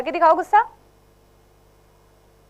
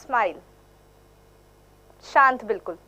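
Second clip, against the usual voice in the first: calm and gentle demo; 7 semitones lower than usual. Shown as a demonstration. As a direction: settle into a gentle smiling calm. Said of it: "हम सौम्य करेंगे तो कैसे करेंगे".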